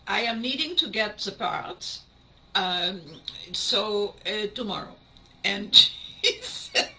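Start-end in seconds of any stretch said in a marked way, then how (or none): 0.1-5.0 halting put-on German accent; mock German repairman, searching for words. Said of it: "That's the best German accent I've got".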